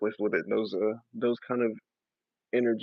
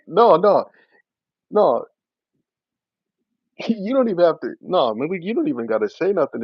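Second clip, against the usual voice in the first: real laughter bursting into a raised, loud voice; amused, laughing; 6 semitones higher, 7 dB louder than usual. Real, not acted. Streamer waving off a viewer's comments, laughing. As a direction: laugh through it, higher and louder. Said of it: "what's making me laugh right now".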